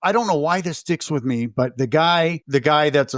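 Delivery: ordinary talk, nothing marked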